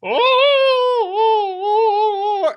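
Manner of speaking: high, drawn-out wailing "oh"; loony wonderstruck cry; amazed old man. Acted. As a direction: let out a long high coo of awe. Old man's astonished outcry.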